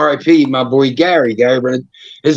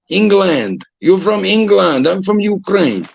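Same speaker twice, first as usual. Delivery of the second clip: deliberate mock Russian accent; thick comic Russian, words stretched; 4 semitones higher than usual. Playing Igor correcting the UK man, thick Russian accent. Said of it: "In Russia".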